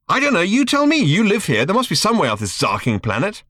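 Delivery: agitated. A narrator's reading. Ford pressing Arthur to find a way off, agitated. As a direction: push him, desperate to get off the planet. Ford frantic to leave planet.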